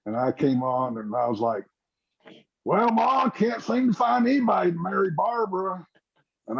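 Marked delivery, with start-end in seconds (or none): 2.6-5.9 lightly voiced panting stage line, raised pitch; a hint of a breathless farm boy calling to Ma; out of breath. Actor reliving his breathless stage entrance to Ma. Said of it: "just panting and everything".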